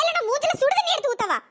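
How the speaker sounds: high, heated threat; angry threat. Acted. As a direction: threaten her, voice rising. Threat to throw hot water.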